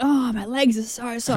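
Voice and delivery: lightly put-on complaint; complaining. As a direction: imitate a partner griping, understated. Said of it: "he was complaining hours after".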